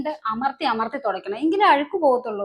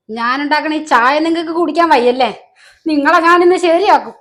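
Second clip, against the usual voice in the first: angry threat; 6 dB louder than usual. Acted. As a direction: explode at her and threaten her. Angry threat over refused tea. (ദേഷ്യത്തോടെ ഭീഷണി)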